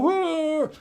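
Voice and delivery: high drawn-out groan; complaining dog moan. Retold or imitated. Speaker mocking people who only complain, groaning like the dog. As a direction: moan like the lazy hurting dog. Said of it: "sit there and go".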